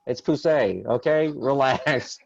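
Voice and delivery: comic panicked calming; mock hushing dad.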